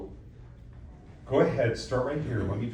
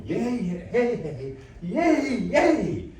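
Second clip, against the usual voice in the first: measured opening, then high, loud cheers; divided, building cheer; 13 semitones higher, 7 dB louder, much slower than usual. Shown as a demonstration. Coach showing student how to divide it, steady then jubilant. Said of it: "I want you to divide it".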